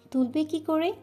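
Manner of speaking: ordinary delivery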